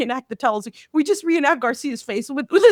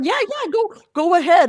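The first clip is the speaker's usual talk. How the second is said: higher, louder brush-off; hurried dismissal; 6 semitones higher, 3 dB louder than usual. Retold or imitated. Butler sending unwanted kids through, mock dismissive. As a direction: wave them through impatiently in a higher voice.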